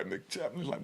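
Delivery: ordinary talk, nothing marked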